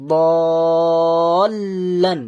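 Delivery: দলান very slow and loud, long first vowel into doubled l; joined lam. Shown as a demonstration. Teacher combining the parts, long joined দলান. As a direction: stretch দলান, l joined before লান. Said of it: "মিলে বললাম, যুক্ত করলাম".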